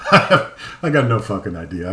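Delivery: laughing through the words; real laugh, slow. Real, not acted. Laughing at Irish question.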